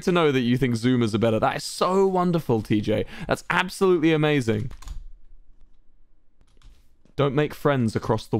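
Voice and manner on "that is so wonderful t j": ordinary talk, nothing marked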